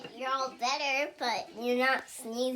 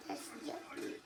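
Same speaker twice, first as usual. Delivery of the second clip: whispered disgust; 14 dB quieter than usual. Real, not acted. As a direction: hush it under the breath. Repeated in a whisper.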